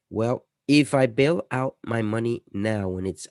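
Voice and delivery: ordinary delivery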